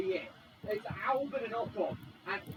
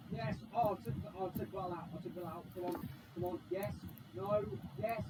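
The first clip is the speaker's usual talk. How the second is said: ordinary talk, nothing marked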